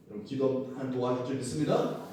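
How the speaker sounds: ordinary delivery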